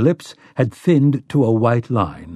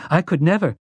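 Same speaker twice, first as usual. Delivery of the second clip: higher-pitched shocked refusal; 6 semitones higher than usual. A narrator's reading. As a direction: protest as if genuinely shocked. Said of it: "sounding genuinely shocked".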